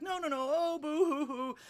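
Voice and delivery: high, loud boo-hoo; mock crying. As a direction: wail it in hammed-up distress, pitched high. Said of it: "She starts to cry even louder".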